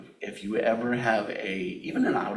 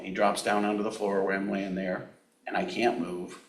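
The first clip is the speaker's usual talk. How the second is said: ordinary delivery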